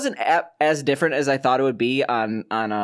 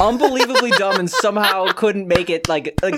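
laughing; 5 semitones higher than usual. Real, not acted. Laughing at himself.

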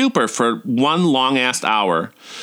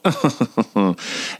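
amused little giggle; a short, falling laugh at your own joke. Real, not acted. Amused at her own hip-thrust story, giggling.

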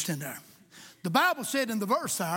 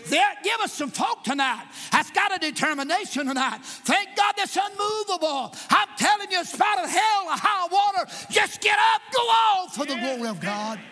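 higher and much louder shouted sermon; full-force preaching; 6 semitones higher, 4 dB louder than usual. Acted. Preacher rallying the congregation, a full-force shouted climax. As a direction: shout it out like a revival peak.

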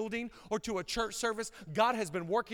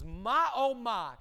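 slow amazed; 5 dB louder, much slower than usual. Acted. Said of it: "he says".

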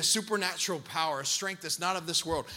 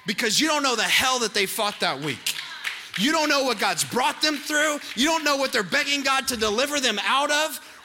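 louder, higher sermon push; impassioned preaching; 6 semitones higher, 7 dB louder than usual. Acted. Pastor warning the congregation not to judge, impassioned preaching. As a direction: drive the point home to the church. Preaching climax about worship.

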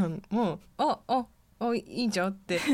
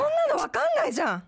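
put-on panicked girlfriend; 4 dB louder, much faster than usual. Drama: escalating quarrel.

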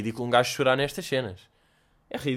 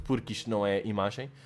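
usual speaking voice

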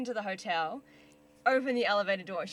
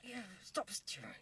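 whispered dad; 4 semitones higher, 5 dB louder, much slower than usual. Retold or imitated. Dad tells his staring daughter to stop, whispering. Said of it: "My dad's like".

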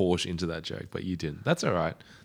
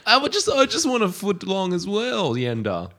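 much higher pleading voice; high whiny plea; 13 semitones higher than usual. Pretend. Dick begging Yenda for a sub, high whiny voice. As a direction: whine for a footlong.

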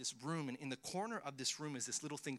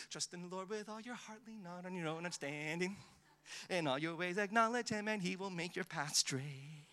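taught nicely; 5 semitones higher than usual. Shown as a demonstration. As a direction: recite it sweetly, like a memory verse for children. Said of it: "And we teach it nicely".

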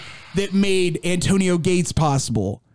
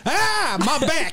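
mock pain; 8 semitones higher, slower than usual. Faking injury from ball.

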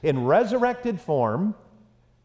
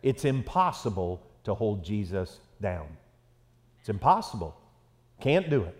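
quiet certainty; 9 semitones lower, 6 dB quieter than usual. Retold or imitated. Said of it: "He just said".

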